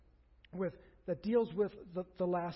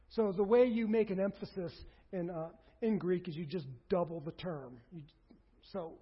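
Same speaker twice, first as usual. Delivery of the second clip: normal talking voice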